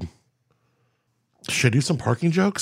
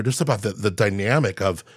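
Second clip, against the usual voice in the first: usual speaking voice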